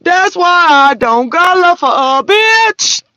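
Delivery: Christ voice. Retold or imitated. Christ warning his people, high, loud and slow. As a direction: deliver it like a stern sermon line.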